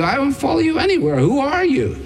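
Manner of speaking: quick, high-pitched brush-off of a stranger; indignant refusal. Acted. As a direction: act out a baffled refusal.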